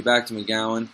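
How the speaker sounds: usual speaking voice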